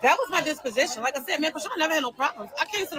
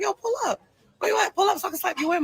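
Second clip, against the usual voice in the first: higher, heated call to come over; angry call; 7 semitones higher, faster than usual. Retold or imitated. Calling Krishan to come over so she can slap, heated and higher. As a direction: demand he pull up, fired up. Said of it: "So I called Krishan like".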